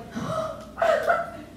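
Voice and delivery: high, horrified gasp; high-pitched dramatic gasp; shocked gasp. Retold or imitated. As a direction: suck in air in shock. Shocked reaction to sentence length.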